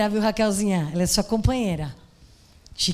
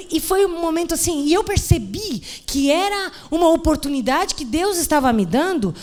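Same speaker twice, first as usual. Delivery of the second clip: ordinary delivery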